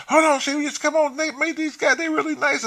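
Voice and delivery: very high mock female voice; imitated carefree woman. Imitating the woman who won't leave her new friends, high-pitched. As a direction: put on her high, oblivious protest.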